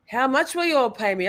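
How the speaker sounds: ordinary talk, nothing marked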